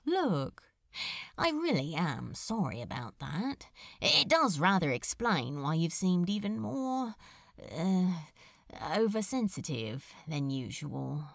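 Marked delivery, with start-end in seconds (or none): 1.4-11.2 a lightly voiced sardonic demon; wry demon. Demon musing to the angel about Hell, lightly voiced sardonic. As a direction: toss it off, dry and lightly lowered.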